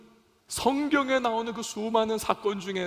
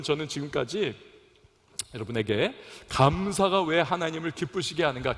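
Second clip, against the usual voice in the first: usual speaking voice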